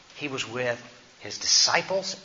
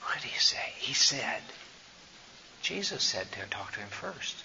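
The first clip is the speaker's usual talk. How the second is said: hushed, half-whispered self-check; soft thinking-aloud under the breath; muttered aside; 10 dB quieter than usual. Real, not acted. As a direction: murmur to yourself while remembering. Storyteller checks himself in an aside.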